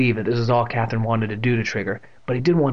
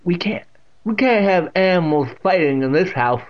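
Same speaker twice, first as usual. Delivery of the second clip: put-on loud, higher old woman; deaf old aunt, stern; 6 semitones higher, 5 dB louder than usual. A narrator's reading. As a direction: forbid it loudly.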